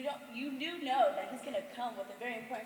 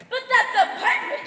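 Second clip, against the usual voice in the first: loud, high, scandalised laugh; shocked laughter; 10 semitones higher, 12 dB louder than usual. Acted. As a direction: gasp it out in shock. Shocked friends.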